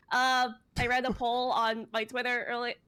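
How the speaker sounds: usual speaking voice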